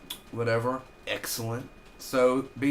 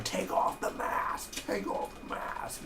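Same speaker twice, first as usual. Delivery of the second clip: intense villain; 3 dB quieter, slower than usual. Retold or imitated. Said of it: "It's really intense".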